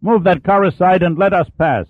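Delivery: impatient driver shouting to clear the road; shouted order. A narrator's reading. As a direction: bark it across the traffic. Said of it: "shouted out his side window".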